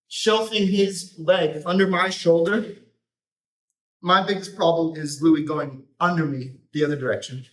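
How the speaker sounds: ordinary talk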